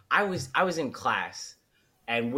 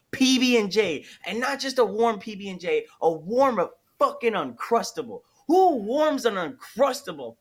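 higher, heated mock outrage; worked-up rant; 6 semitones higher than usual. Real, not acted. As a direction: go off on it like it's a crime. Ranting about warm sandwiches.

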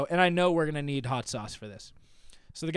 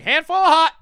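very high, loud order call; imitated yell; 13 semitones higher, 13 dB louder than usual. Customer mimicking the worker's sauce order, yelling. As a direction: belt it like the sauce guy. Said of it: "He turns around and yells".

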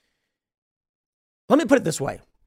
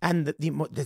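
ordinary delivery

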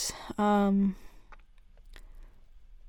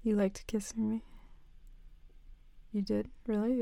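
ordinary delivery

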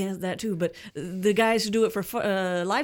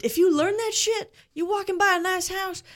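teasing; 10 semitones higher than usual. Pretend. Teasing friend about burglary.

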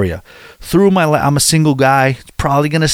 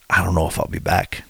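ordinary delivery

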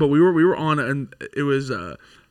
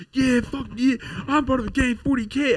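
higher mock gang boast; imitating a boasting kid; 9 semitones higher than usual. Retelling what the gang kid shouted in chat, higher. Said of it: "all I hear was".

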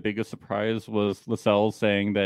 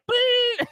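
high-pitched excited squeal. Retold or imitated. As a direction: squeal like a crowd of kids. High squeal imitating crowd.

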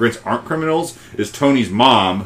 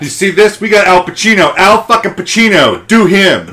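loud, high, hammed-up excited director; hyped-up director; 10 semitones higher, 7 dB louder than usual. Mock director pitching Pacino to the cast, loud and excited. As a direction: sell the cast on the star, pumped.

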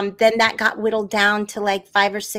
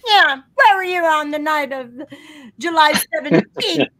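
hammy detective, loud and higher; 5 semitones higher, 3 dB louder than usual. Acted. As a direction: grill the suspect like a noir cop. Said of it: "This is my detective voice".

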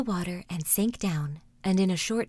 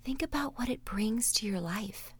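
whispered meditation; 8 dB quieter than usual. Acted. Meditation host guiding listeners: soft whisper. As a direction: whisper them gently through the exercise.

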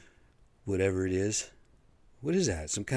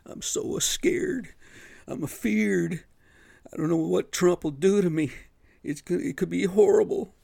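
high, loud, whiny mock-frightened voice; hammed-up squeal of a cowardly politician; mock scared politician; 11 semitones higher, 7 dB louder than usual. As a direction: parody a spineless politician quaking in a high, loud whimper. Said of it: "They're so fucking scared".

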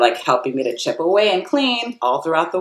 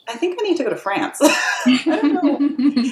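laughing; 10 semitones higher than usual. Real, not acted. Laughing at her own realization.

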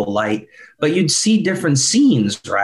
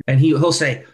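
usual speaking voice

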